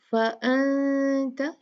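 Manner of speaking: फ़अन्त with a hidden, nasal n, slow; ikhfa demo. Shown as a demonstration. Teacher demonstrating ikhfa to students, slow and nasal. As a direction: blur the n of फ़अन्त into a nasal hum. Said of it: "यहाँ पर हम इख्फा करेंगे".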